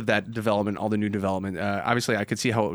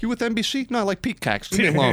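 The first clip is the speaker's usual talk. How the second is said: mock defensive retort; 8 semitones higher than usual. Mock exchange about the peacock.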